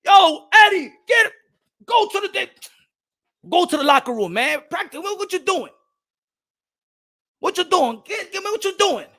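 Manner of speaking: high, loud angry coach; coach shouting. Retold or imitated. Coach kicks Eddie out of practice, loud shout. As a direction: throw him out of practice. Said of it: "The coach kicked me out".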